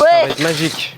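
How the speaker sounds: excited. Real, not acted. Excited about the zipline.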